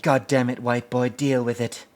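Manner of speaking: lightly voiced tough woman, laughing through it; mock Ripley chewing him out, half giggling; a hint of Ripley scolding Hudson. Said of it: "She's like".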